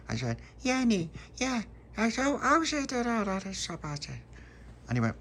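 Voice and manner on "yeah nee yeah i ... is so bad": put-on baby babble. A narrator's reading. Baby Lucia babbles to Tony about the jam, very high. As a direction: babble like a toddler.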